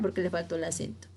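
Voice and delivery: ordinary delivery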